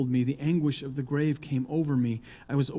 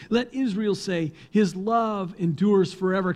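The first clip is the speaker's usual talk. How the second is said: higher, louder liturgical call; responsive call; 6 semitones higher, 5 dB louder than usual. Acted. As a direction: call it out to the congregation. Leading psalm responsively.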